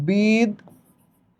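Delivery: বিদ্যান drawn out slowly as "bid... dan"; syllable by syllable. Shown as a demonstration. Teacher recalling the written pronunciation, slow bid-dan for বিদ্যান. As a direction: split বিদ্যান at the doubled d, very slowly. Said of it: "উচ্চারণ কেমনে লিখলাম".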